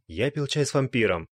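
plain reading voice

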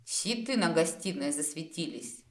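ordinary delivery

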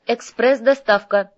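loud, high, drawn-out announcement; calling out. Acted. Courier announces himself from the portal, calling out loudly. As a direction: sing out like an arriving courier.

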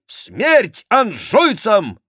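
high, slow, loud shout; battle cry. A narrator's reading. Chicot rallies against the Angevins, shouted battle cry. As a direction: cry it out for all Paris to hear. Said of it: "Этот крик".

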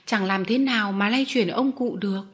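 usual speaking voice

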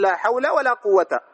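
"حول" and "قوة" both ending in bare -a; "حول" and "قوة" drawn out, fatha without tanwin. Shown as a demonstration. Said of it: "نعمل لا هنا وهنا".

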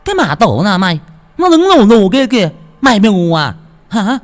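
high, loud hissed shriek. A narrator's reading. Tẹo shrieking at Thơm about the figure by the tree, angry. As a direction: screech at her for being blind. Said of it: "Con Tẹo rít lên".

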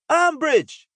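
high, loud isolated shout; shrieked poster blurt. A narrator's reading. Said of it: "em um tom progressivamente mais alto".

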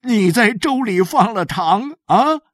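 lightly acted happy amazement; delighted husband. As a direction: marvel at the wife's surprise, starting high and loud. Voices husband moved by surprise.

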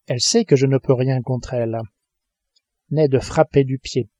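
plain reading voice

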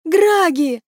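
high, desperate entreaty; pleading. A narrator's reading. Maeve begging the gods for a name, desperate plea. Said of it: "взмолилась Мэв".

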